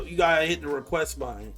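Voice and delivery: usual speaking voice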